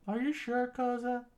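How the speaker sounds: high, uncertain question; doubtful rebel. Acted. Rebel asking the leader if he is sure. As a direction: ask the leader warily.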